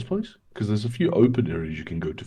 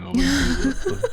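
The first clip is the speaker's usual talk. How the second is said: laughing; 5 dB louder, slower than usual. Retold or imitated. Joking self-quote with laugh.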